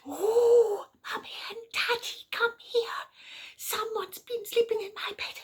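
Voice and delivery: very high squeaky little cub; tiny excited voice calling his parents; Baby Bear. Acted. Baby Bear calling Mummy and Daddy to his bed, high and squeaky. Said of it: "Baby Bear from his bed ... said".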